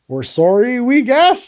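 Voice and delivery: acting out a helpless, unsure apology; rising, tentative retold apology. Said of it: "just looking on helpless, being like".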